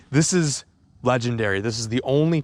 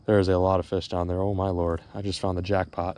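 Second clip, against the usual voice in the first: ordinary talk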